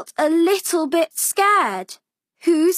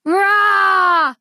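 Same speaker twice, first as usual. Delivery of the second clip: a higher, louder, playful child's mock roar; little girl's roar; 5 semitones higher, 4 dB louder than usual. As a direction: roar like a small girl pretending to be a monster. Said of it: "said Posy".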